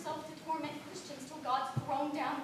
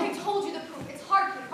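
louder, bristling comeback; defiant retort; 2 semitones higher, 9 dB louder than usual. Acted. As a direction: fire it back at him. Servant defies master.

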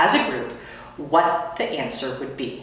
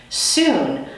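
usual speaking voice

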